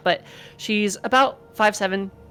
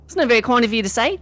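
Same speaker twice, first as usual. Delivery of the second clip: indignant Aussie, pitched up and faster; Australian accent; 6 semitones higher than usual. Acted. Zondra taking offence in an Australian accent. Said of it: "everyone from Rabios speaks like that".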